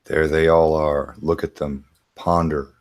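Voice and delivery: slow, low, level drone; mock monotone. Co-host echoing the joke about the proofs, slow monotone. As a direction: drone it solemnly with no inflection.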